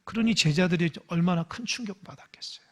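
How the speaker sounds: hushed whisper to the congregation; whispered sermon aside. Real, not acted. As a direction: lean in and whisper the point. Preacher drops to whisper.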